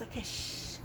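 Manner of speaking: soft shushing whisper. Real, not acted. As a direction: hush yourself quietly. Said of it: "I'm gonna wake everybody up".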